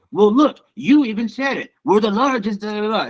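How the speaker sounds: high-pitched, arrogant politician impression; Donald Trump. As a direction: put on a boastful president's voice. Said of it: "The way Donald Trump would say it".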